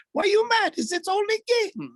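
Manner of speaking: hammed-up, very high, loud goalie impression; exaggerated high-pitched athlete imitation; Sergei Bobrovsky. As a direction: squeal it as a comic mock protest. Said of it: "He's just like".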